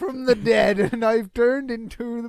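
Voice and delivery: loud, high, drawn-out Scottish character voice; Lumpy. Acted. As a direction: stretch it out slowly, high and loud. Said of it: "why is Lumpy doing this?".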